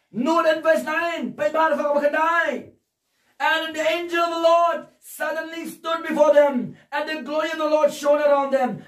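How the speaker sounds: high, sustained scripture proclamation; full-force preaching. Real, not acted. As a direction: declaim the verses to the congregation.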